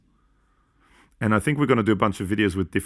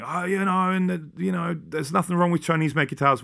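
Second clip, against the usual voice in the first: mocking commenter; 9 semitones higher than usual. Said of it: "put a comment on saying".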